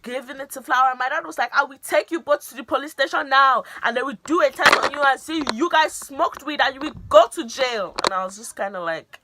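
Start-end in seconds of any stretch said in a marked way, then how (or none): 1.6-8.0 loud, raised threatening lecture; imitating stern dad threat. Dad threatening teens with police, loud and stern. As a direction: lay down the threat like a strict father.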